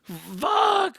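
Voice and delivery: high, rising to a loud shout; nervous yelling. Retold or imitated. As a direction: cheer on the fight in a panicky yell. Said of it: "yelling out stupid shit that I don't mean".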